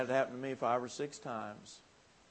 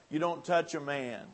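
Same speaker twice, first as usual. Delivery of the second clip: normal talking voice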